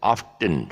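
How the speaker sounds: "Often" as OFF-ten; T sounded. Shown as a demonstration. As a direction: hit the T in "Often". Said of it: "we make the mistake for this word".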